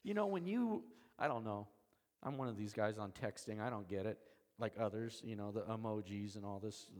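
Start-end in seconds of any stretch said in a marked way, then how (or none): none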